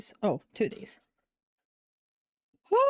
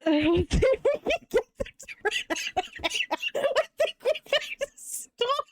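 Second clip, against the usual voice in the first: squeaky, airless giggle-whisper, high and slow; trying to talk through helpless laughter; breath gone, words squeezed out high between laughs; laughing fit; 10 semitones higher, slower than usual. Real, not acted. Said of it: "My sides hurt".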